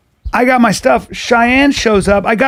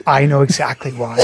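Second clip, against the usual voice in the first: mimicked deadpan; 6 semitones lower than usual. Comic retelling what Jimmy said, mimicked deadpan. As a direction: lower retold deadpan reply. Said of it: "he goes like this".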